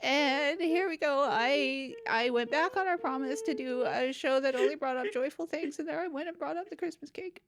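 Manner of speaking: high, tearful apology that keeps breaking into sobs; crying. Real, not acted. Host crying while apologizing to listener Parker. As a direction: let the tears take over the words. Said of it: "I didn't expect to need these tissues".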